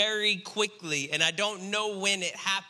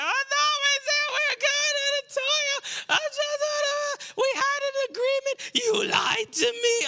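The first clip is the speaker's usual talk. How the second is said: put-on much higher wailing child's sob; imitating crying child. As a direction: wail like a betrayed little girl. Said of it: "she's crying".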